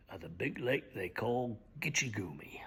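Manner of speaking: hushed, low whisper; whispered refrain. Acted. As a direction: let the refrain fall to a whisper.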